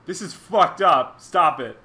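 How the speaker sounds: loud, slow, emphatic reproach; mock a concerned friend putting their foot down; stern intervention. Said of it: "people who loved them and cared about them said".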